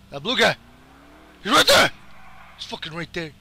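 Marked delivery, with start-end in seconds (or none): 1.4-1.9 high, loud alert; excited yell. Real, not acted. Gamer spotting the blue guy, excited yell. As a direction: shout as you spot him. Spots enemy.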